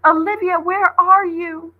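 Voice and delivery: high, loud, slow searching call; lost voice calling through the corn. A narrator's reading. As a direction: call out, looking for her. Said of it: "it called".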